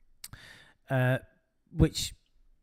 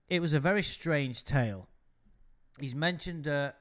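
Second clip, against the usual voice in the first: ordinary talk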